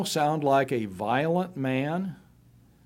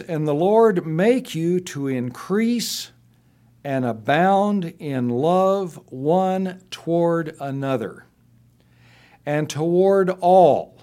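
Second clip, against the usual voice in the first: slow, raised and fuller reading of the blessing; scripture reading; 3 semitones higher, 4 dB louder than usual. Acted. Pastor reading Paul's blessing on the church, slow and fervent. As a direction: stretch the blessing out with feeling.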